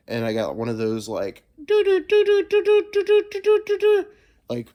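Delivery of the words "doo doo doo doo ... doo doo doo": high sung alert melody; sing-song alarm jingle, much higher; imitated alert jingle. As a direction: toot out a phone alert tune. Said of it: "like the freaking old-timey newspaper alerts".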